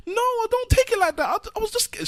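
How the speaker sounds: very high imitated woman's voice, pleading; scared woman. Cornered woman explaining to the chaser, high pleading voice. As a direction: squeak out a frightened excuse. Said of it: "And she's like".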